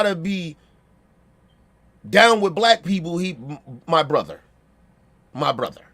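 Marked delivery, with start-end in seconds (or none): 3.3-5.8 low, soft mock-proper brother greeting; lowered corny fake-down voice; mocking a phony attempt to sound down. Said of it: "it doesn't even sound right".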